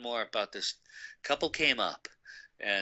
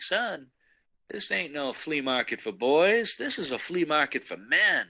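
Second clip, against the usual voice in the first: higher-pitched country dad laying down the law; 5 semitones higher than usual. Retold or imitated. Dad setting his son straight at the flea market, country voice. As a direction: put on a folksy country voice. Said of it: "in, like, some country voice".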